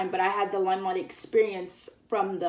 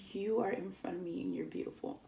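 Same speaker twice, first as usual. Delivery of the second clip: breathless awed whisper to herself; starstruck whisper; 11 dB quieter than usual. Retold or imitated. Fan gazing at Corbin, whispering in awe. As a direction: barely voice it, dazzled.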